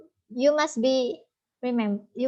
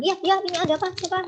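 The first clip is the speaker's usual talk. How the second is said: hurried, higher, sharper phone answer; rushed caller; 8 semitones higher, 3 dB louder, much faster than usual. Shown as a demonstration. Guru menirukan orang terburu-buru menjawab telepon. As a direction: blurt it out like you're in a rush. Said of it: "jangan seperti orang terburu".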